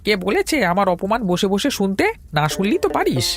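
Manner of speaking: high-pitched, agitated complaint; exasperated scolding. Acted. Tea seller telling a pestering customer to go elsewhere, exasperated. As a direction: push him away with high, fussing indignation.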